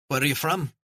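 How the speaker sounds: put-on high child's voice in a broad Scottish accent; a cheeky Scottish kid calling out, broad accent; broad Scottish boy. A narrator's reading. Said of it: "the boy said in a broad Scottish accent".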